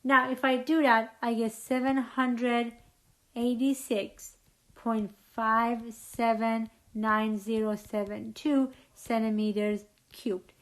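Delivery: ordinary delivery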